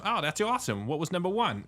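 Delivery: high Boston wife voice; imitating a pleased Bostonian wife; Boston accent. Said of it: "and she goes".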